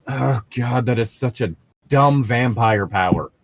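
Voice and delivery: normal talking voice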